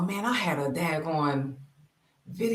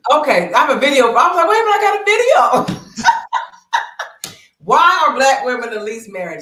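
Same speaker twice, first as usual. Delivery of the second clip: loud, high, tipsy and giggling; 9 semitones higher, 12 dB louder than usual. Real, not acted. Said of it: "this is what I get for drinking".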